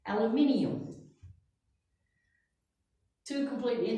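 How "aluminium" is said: "aluminium" in five syllables, stress on NI; al-u-MIN-i-um, the British way; British English "aluminium" stressed on the fourth syllable. Shown as a demonstration. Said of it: "they say it with".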